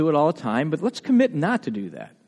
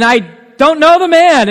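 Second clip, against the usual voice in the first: strongest oath; 11 semitones higher, 12 dB louder than usual. Acted. Peter's final denial before the rooster crows, vehement oath. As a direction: swear it at full force, pitched high. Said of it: "the strongest oath I can".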